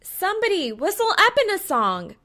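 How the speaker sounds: high, loud shout to a crowd; rally the onlookers with a call. A narrator's reading. Calling out to cameras and crowd.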